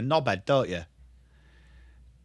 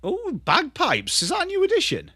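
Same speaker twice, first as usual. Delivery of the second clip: surprised; 13 semitones higher than usual. Real, not acted. Surprised by bagpipe sound.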